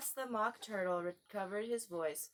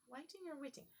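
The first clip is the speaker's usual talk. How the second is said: faint murmur; 8 semitones higher, 12 dB quieter, faster than usual. Acted. Faint aside.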